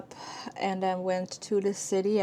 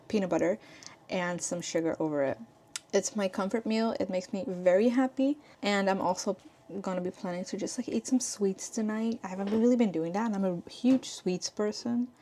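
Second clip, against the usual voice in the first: ordinary talk, nothing marked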